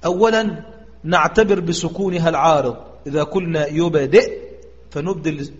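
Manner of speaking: ordinary talk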